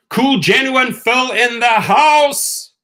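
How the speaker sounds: loud, high, drawn-out hype greeting; excited shout-out. Real, not acted. Streamer hails Phil joining the chat, excited shout-out. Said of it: "shouting out, excited".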